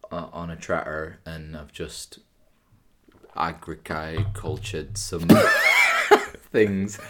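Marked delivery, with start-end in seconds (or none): 3.4-7.0 laughing through the words; breaking into a laugh mid-phrase. Real, not acted. Joking about farm life.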